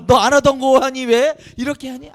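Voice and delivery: loud, high-pitched parental disbelief; mock suspicious parent. Parent to suddenly obedient child, loud mock suspicion. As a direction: play a baffled parent, loudly and high. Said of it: "뭐라 그러시지?".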